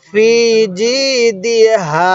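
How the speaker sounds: ফি and জি drawn out, then দি হা; measured ফি জি দি হা with pauses between; slow model reading. Shown as a demonstration. Teacher showing how to read, stretched ফি and জি. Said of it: "তাহলে পড়ুন".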